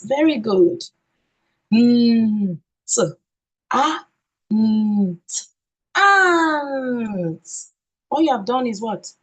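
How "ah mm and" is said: high teacher demo sounding out ah, mm, and; segmenting the sounds. Shown as a demonstration. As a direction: build "and" from its sounds, high and slow. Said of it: "what can you hear in the word and".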